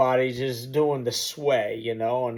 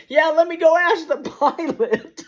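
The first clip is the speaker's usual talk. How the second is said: much higher, words spilling through laughter; joking while cracking up; laughing; 12 semitones higher, 4 dB louder than usual. Retold or imitated. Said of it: "I'm thinking".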